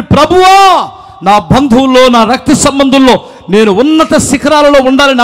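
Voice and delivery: loud cry to the Lord; acted loud plea; bold prayer. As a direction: call out to God at full voice, as a model prayer. Models a bold prayer to God.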